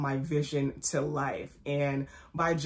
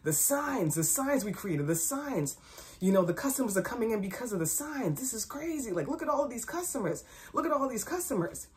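higher, softer retold astonishment; amazed coworker; 5 semitones higher, 4 dB quieter than usual. Employee telling Key the signs brought customers in, retold amazement. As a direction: marvel at it, imitating an excited coworker. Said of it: "one of the other employees stated to me".

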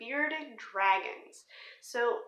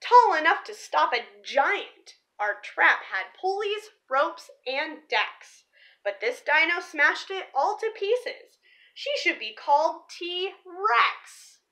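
lively picture-book read-aloud; story narration. Acted. Reading a dinosaur picture book to viewers, storybook voice. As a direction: read the rhyming story to the kids.

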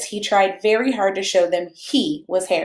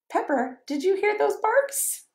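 plain reading voice

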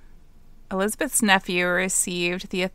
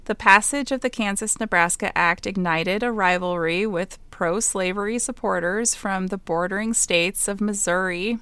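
ordinary talk, nothing marked

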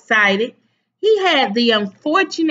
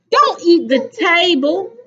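higher-pitched motherly scolding; mildly exasperated, raised complaint; complaining; 6 semitones higher, 4 dB louder than usual. A narrator's reading. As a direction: scold a child mid-bite. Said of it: "complained his mother".

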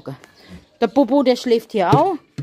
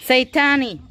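normal talking voice

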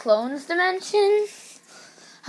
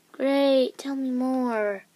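ordinary delivery

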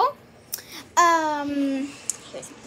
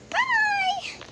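cheery goodbye. Real, not acted. Sign-off to viewers.

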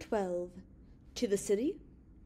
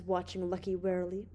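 usual speaking voice

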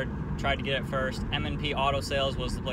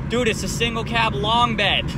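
high, loud mock exasperation; retold exasperation; 7 semitones higher, 5 dB louder than usual. Customer to the clerk who keeps not listening, exasperated. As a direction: spell it out loudly for someone not listening. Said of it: "he just kept not listening to me".

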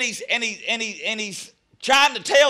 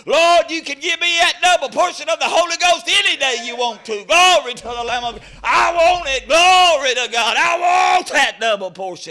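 fervent preaching; 5 semitones higher, 5 dB louder than usual. Real, not acted. Pleads for double portion, rising.

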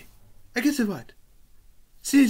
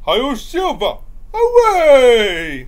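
high rising shout; silly clown shout; 7 semitones higher, 11 dB louder, much slower than usual. Retold or imitated. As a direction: belt it out loudly. Shouted Lone Ranger call.

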